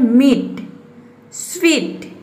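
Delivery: मीट and स्वीट with a long, stressed ee. Shown as a demonstration. Teacher shows students मीट and स्वीट with a stressed long ee. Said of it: "बड़ी ई जोर देके इसको बोलना".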